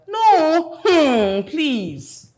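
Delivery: loud, high, indignant no; emphatic refusal. Acted. Refusing to match a friend with her brother, loud and indignant. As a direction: refuse loudly and pointedly.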